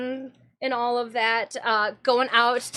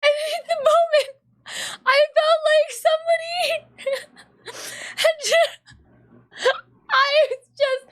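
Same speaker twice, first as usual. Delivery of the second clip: laughing; 13 semitones higher, 4 dB louder than usual. Real, not acted. Laughing hard while retelling embarrassment.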